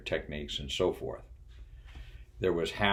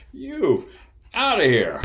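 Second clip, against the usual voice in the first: a big shouted order, far louder and higher; booming order; 12 dB louder, slower than usual. Retold or imitated. Bob bellows at the clueless ops specialist to leave. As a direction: roar her out of the room. Bob throws her off desk.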